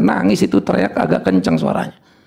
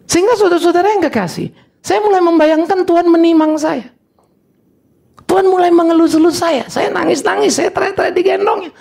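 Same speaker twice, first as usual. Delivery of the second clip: high-pitched, fervent sermon delivery; impassioned preaching; 13 semitones higher than usual. Acted. Preacher telling congregation how God cradles him, impassioned.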